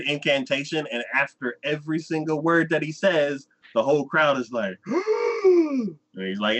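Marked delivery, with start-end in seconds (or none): 4.9-6.6 mock gasp. Crowd gasps at each word.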